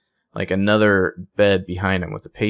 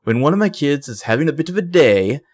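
imitating weird British accent; 6 semitones higher, 4 dB louder than usual. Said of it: "This guy has the weirdest accent".

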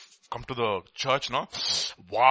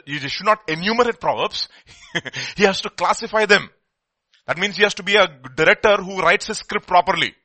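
ordinary talk

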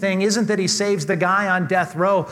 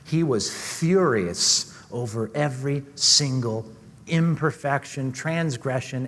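usual speaking voice

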